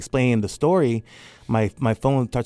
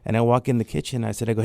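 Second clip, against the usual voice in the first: ordinary talk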